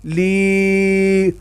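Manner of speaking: لی drawn out as leeee; long lee. Shown as a demonstration. Teacher models the long vowel for the class. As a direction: hold the ee in لی long. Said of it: "لام زیر یا".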